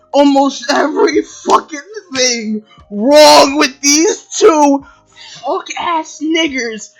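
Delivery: angry shout. Real, not acted. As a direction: shout it, slowing on the last words. Ranting about the two characters.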